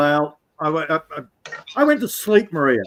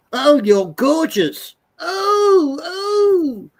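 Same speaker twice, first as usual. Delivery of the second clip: much higher, whining mock swoon, slow; mocking swooning simps; 11 semitones higher, much slower than usual. Mocking simps in the chat with a hammed-up swoon. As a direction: ham up a lovesick fan crying out. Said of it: "all the simps in the chat going".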